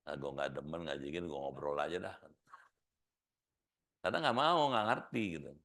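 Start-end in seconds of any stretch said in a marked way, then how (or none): 0.1-2.2 bored listener. Retold or imitated.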